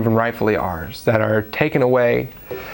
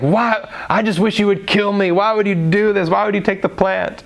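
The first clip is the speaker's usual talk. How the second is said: mock tantrum wail; 7 semitones higher than usual. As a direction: play Jonah screaming at God, hammed-up. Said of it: "screams at God".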